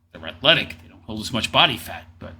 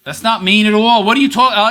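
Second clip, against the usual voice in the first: high, loud scoffing outburst; incredulous and raised; loud indignant objection; 7 semitones higher, 7 dB louder, faster than usual. Real, not acted. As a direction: protest it loudly, pitched way up. Indignant reaction to interview remark.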